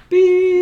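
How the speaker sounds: high, loud censor bleep; mock censor beep. Censoring a joke.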